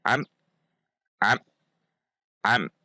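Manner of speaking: am chopped off abruptly; jerked, cut short. Shown as a demonstration. Teacher shows forbidden reading, am jerked short. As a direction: jerk am, snapping the M short.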